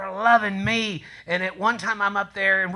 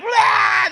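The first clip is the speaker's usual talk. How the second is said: panicked yell; 13 semitones higher, 5 dB louder than usual. Retold or imitated. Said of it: "And we were like".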